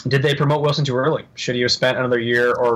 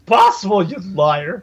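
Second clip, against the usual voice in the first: mock outrage; 4 semitones higher, 3 dB louder than usual. Mock-outraged pushback at friend.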